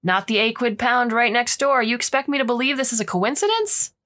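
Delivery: angry accusation. Acted. Forewoman yelling accusation.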